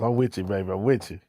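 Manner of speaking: ordinary delivery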